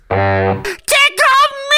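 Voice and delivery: far-off, loud silly voice; a ridiculous loud creature begging to be tickled. Acted. Host voicing a silly creature in a horror story.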